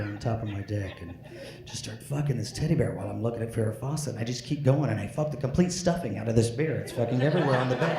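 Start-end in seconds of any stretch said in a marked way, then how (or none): none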